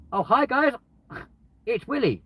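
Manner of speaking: high, cheerful hello to the group; chirpy intro. Shown as a demonstration. Greeting a group of strangers, high and cheerful. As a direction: breeze in sounding innocently cheerful. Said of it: "And then go".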